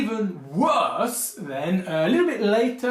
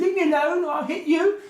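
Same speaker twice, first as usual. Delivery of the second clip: put-on much higher childish threat; a bullied kid squeaking back; mock child; 10 semitones higher than usual. Bullied kid threatening the bully, high squeaky. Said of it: "stood up to someone and said".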